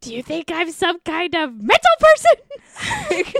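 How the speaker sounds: high, loud mock-hysterical shriek; hammed-up outburst. Girlfriend exploding in front of his friends, hammed-up hysterical outburst. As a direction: build to an unhinged mock yell. Acted outburst at the poker fight.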